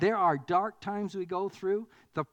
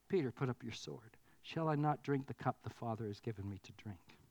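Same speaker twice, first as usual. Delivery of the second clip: much softer and lower, calm rebuke; calm Jesus; 6 semitones lower, 8 dB quieter than usual. Retold or imitated. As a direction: lay the sword question down softly. Jesus calmly stopping Peter's sword.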